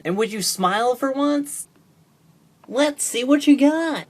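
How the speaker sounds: high-pitched put-on pleading voice; pestering skit character. One toy character asks another to smile, high nagging voice.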